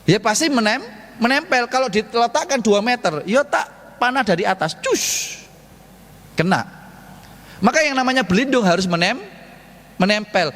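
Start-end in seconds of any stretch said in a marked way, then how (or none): 4.8-5.4 high unvoiced whoosh of an arrow. Preacher imitates his arrow flying over the shield, a high whoosh.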